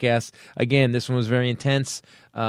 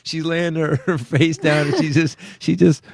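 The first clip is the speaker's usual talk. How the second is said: high-pitched, struggling through laughter; laughing; 5 semitones higher than usual. Real, not acted. Comic describing the runner's fall to his co-hosts, laughing. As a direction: tell it while trying not to crack up. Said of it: "I'm laughing".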